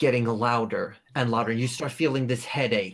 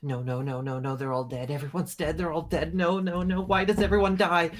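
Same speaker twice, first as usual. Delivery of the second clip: panicked spiralling grief; 6 semitones higher, faster than usual. Acted. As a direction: let the frantic thoughts tumble out and build. Sin's panicked thoughts flood everyone.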